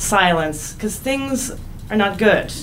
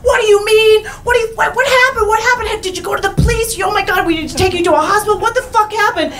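livid shouting; 13 semitones higher, faster than usual. Retold or imitated. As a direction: yell in shocked outrage. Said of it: "And he was livid".

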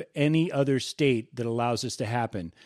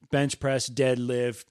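ordinary talk, nothing marked

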